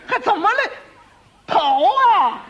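very high, loud exasperated shout; panicked yell. Acted. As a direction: blurt it out at full cry in a panic. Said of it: "他一着急，他嚷嚷出来了".

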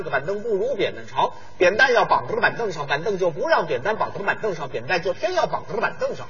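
"扁担要绑着板凳上" rattled off at top speed; fast tongue twister. Shown as a demonstration. Showing off a tongue twister at full speed to his partner. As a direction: race through "扁担要绑着板凳上" in one breath. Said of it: "讲得很快".

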